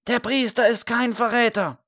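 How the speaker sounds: high-pitched answering call; answering shout. A narrator's reading. A second voice in the crowd defends the priest, high answering shout. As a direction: echo the first cry from across the crowd. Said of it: "ein Echo antwortete gerade gegenüber".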